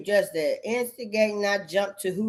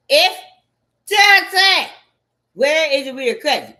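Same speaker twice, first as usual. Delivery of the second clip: loud, higher demanding shout; raised rant; 6 semitones higher, 10 dB louder than usual. Real, not acted. Ranting to the audience, a loud demanding shout. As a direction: push it out loud and high.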